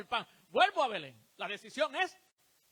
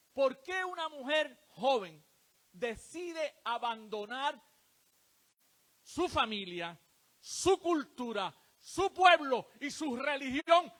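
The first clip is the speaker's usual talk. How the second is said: slow, louder build through the list; emphatic preaching; 4 semitones higher, 8 dB louder, slower than usual. Real, not acted. Preacher asking the congregation why Ruth left, slow build. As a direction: drive each word home.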